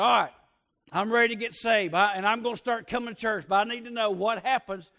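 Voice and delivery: high, loud, emphatic announcement; loud declaration. Retold or imitated. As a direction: act out a man loudly declaring he's ready. Acted convert challenging the preacher.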